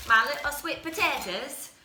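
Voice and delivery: a hint of an Irish accent, said playfully; Irish man. Cooking vlogger accidentally sounding like an Irish man. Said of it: "I sound like an Irish man".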